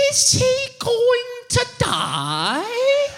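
put-on high, very slow, tearful child voice; crying little boy. Son asks if his father will die, high tearful child. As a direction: stretch it out like a kid about to cry. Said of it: "And he said".

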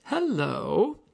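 high, drawn-out greeting lifting at the end; insecure hello. Retold or imitated. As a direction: imitate an unsure teller's rising hello. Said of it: "some kind of insecurity".

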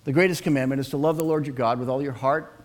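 ordinary talk, nothing marked

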